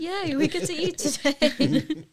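put-on high, slow, gleeful kid voice; lightly voiced child cheering; child's cheer. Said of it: "I would yell".

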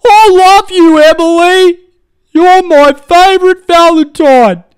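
high, slow, hammed-up lovey-dovey reading; mock sappy card voice. Host mocking listener's Valentine card in a sappy voice. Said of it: "You wrote her a card".

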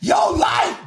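very high, shouted protest; hammed-up meltdown, voice shooting way up; mock fired-up. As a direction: imitate a man wildly protesting his innocence. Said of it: "came on all fired up".

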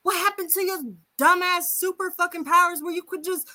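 mocking rant. Mock rant at reformed hero.

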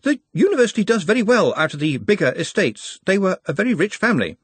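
a hint of a worried archivist; Master of the Traditions. A narrator's reading.

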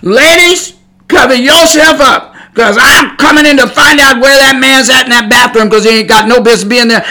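loud, high holler into the bathroom; yelling warning. Retold or imitated. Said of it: "I would have been yelling in that bathroom".

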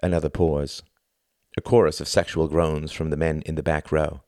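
plain reading voice

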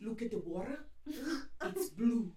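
put-on slow Russian accent, chunk by chunk; stern-sounding Russian accent. Russian framer advises on frame color, stern imitated Russian accent. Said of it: "eu imitava o sotaque".

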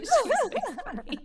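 squeaky high laugh through the words; can barely get it out for laughing. Real, not acted. Granddaughter laughing about her funny grandma, high and breaking up.

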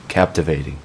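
ordinary delivery, nothing marked

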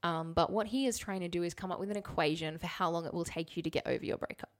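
normal talking voice

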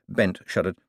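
usual speaking voice

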